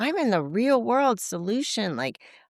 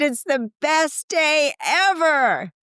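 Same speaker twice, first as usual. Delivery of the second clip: high, loud, very slow and drawn-out; gushing delight; 10 semitones higher, 5 dB louder, much slower than usual. Retold or imitated. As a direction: stretch every word with joy. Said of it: "I said".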